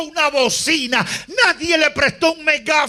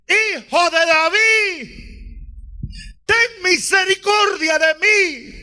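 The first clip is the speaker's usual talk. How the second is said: the beggar shouting over the crowd; crying out; 8 semitones higher than usual. A narrator's reading. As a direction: beg for mercy at the top of the voice. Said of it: "siguió gritando".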